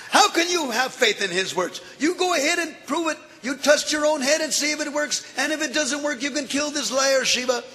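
high, taunting provocation; goading challenge. Acted. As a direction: dare him to try it. Narayan goading the demon.

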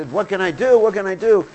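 high, loud pleading; mock desperate king. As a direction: beg like a man undone, hammed up.

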